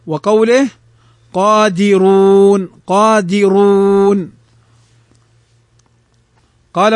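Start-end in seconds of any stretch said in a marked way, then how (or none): 1.3-4.3 slow, held قادرون with a thick r; heavy ra. Shown as a demonstration. Teacher recites قادرون as example, heavy ra. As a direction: stretch the vowels of قادرون, r heavy. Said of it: "فالراء مضمومة فتفخم".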